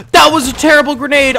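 loud, high cry of dismay; shocked shout. Real, not acted. Gamer shouting at his own bad grenade. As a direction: yell it as the grenade goes wrong.

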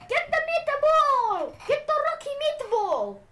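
high, loud urging; urgent yelling. Real, not acted. Player urging the creature to eat, urgent yell.

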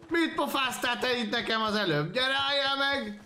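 high, loud-edged taunting challenge; confrontational shout. Real, not acted. Player yelling at a fleeing opponent, confrontational shout. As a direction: yell it after someone getting away.

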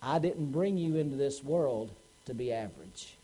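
low, soft, solemn verdict; quiet disappointed father laying down the law; grave father. Acted.

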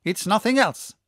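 a hint of a laughing shout. A narrator's reading. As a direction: shout it back, amused and certain. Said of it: "shouted the professor with a loud laugh".

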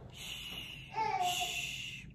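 breathy unvoiced shh to hush a child; soft shushing. A narrator's reading. Mother donkey hushing her colt, a whispered shh. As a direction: hush him gently, all breath. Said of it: "be quiet," Mama said".

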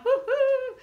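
very high, laughing whoop; squealed cheer; gleeful woo-hoo. Pretend. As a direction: let out a happy woo-hoo. Celebrates giving sunshine.

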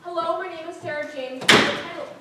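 loud, insistent speech opening; defiant declaration. Acted. Student starting her speech against orders, loud and defiant. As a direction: push the introduction through over objections.

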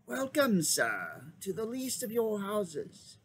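lightly voiced old magician, lifted high and welcoming; a hint of a courtly old wizard greeting his lord. A narrator's reading. Old magician bowing to Aslan, lightly voiced courtly welcome.

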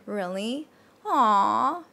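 scoop "Aw" up as if touched; sweet surprise. Shown as a demonstration. Teacher likens rising tone to a reaction, sweetly surprised. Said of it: "kinda surprise kinda tone".

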